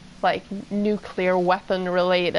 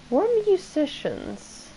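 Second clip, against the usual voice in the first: slow, higher mock Finnish accent; 6 semitones higher, slower than usual. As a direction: imitate a Finnish-accented band member, slowly. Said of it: "that's Finnish accent in my head".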